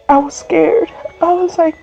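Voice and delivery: normal talking voice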